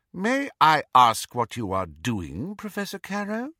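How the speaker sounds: lightly voiced stern teacher; stern professor. A narrator's reading. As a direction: coolly demand an explanation, rising at the name. Teacher challenges man at door.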